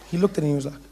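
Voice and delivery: normal talking voice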